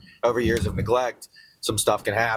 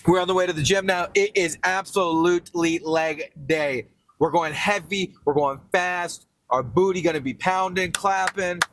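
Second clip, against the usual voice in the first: hyped up; 7 semitones higher than usual. Real, not acted. Hyped up heading to gym.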